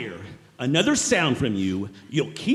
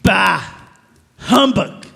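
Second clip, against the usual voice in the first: scornful outburst; 4 semitones higher, 10 dB louder, slower than usual. Acted. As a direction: bark it out loud and high in contempt. Scrooge scorns nephew's Christmas greeting.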